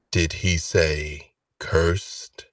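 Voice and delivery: low, very slow voice; mysterious lord. Acted. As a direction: drop low and draw out each word. Unseen voice from high window.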